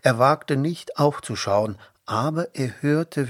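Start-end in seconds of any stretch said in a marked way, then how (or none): none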